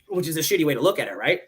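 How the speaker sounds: usual speaking voice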